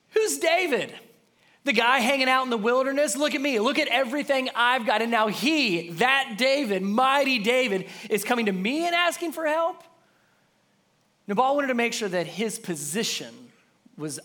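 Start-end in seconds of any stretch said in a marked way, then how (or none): 0.1-9.8 arrogant rich man. Retold or imitated. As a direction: puff himself up and scoff at David. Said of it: "He's saying".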